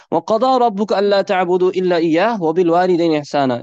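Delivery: fast, chanted Quranic recitation; chanted Arabic verse. Acted.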